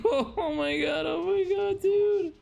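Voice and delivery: high-pitched laughing disbelief. Real, not acted. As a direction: squeal it through laughter. Laughing at game disaster.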